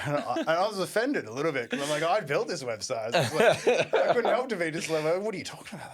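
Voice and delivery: high, loud, laughing retort; laughing indignation. Real, not acted. Host retelling being offended by the website jab, laughing indignantly. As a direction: protest through laughter.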